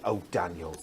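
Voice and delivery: low, soft imitated Scottish accent. Crafter retells his friend's phone reply, Scottish accent. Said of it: "She said it in a Scottish accent".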